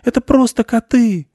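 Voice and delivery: high, loud desperate mouse; frantic little mouse cry; screaming with all might. A narrator's reading. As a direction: yell it in a squeaky panic. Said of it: "закричал изо всех сил мышь".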